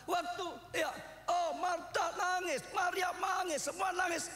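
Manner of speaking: high, ringing retelling of the story; preaching at full force. Acted. Preacher telling how Jesus wept, fervent and high. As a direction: cry the story out to the hall. Preacher tells mourning at Lazarus.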